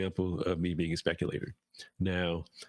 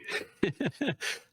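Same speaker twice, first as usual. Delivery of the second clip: high amused laugh bursting out; burst of laughter. Real, not acted. Joking to viewers about the flu, short high laugh. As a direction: laugh at his own joke.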